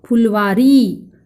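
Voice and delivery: फुलवारी slow, with long final ई; pronunciation demo. Shown as a demonstration. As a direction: model फुलवारी slowly for pupils. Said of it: "कैसे उच्चारण होगा?".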